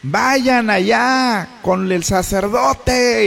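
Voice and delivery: slow, high-pitched holler; drawn-out distant command; calling back from afar. Acted. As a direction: shout an order across a distance. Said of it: "a lo mejor sí les gritó también".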